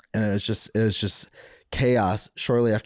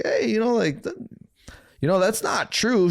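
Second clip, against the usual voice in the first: gentle reminder; 13 semitones higher than usual. Retold or imitated. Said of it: "I'm just like".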